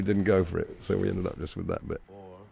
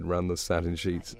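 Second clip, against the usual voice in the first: ordinary talk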